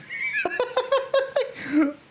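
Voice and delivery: high giggling laugh; a helpless high-pitched laugh. Real, not acted. Laughing at a busty drawing, high giggle.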